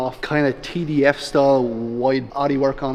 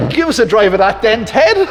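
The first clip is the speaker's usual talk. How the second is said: mate asking for a drive; 8 semitones higher, 8 dB louder than usual. Retold or imitated. Friend's request retold, voiced loudly.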